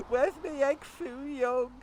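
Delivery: put-on high, whiny little kid; crying child. Six-year-old kids crying for Mister Chan's food, high whiny child voice. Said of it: "crying their eyes out in the pyjamas going".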